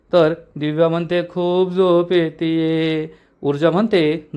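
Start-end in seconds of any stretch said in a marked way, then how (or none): 1.3-3.1 slow, drawn-out sleepy mumble; sleepy whine. A narrator's reading. Divya pleading sleep to dodge Urja, slow and drowsy. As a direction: stretch every word like she can barely stay awake.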